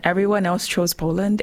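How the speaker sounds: normal talking voice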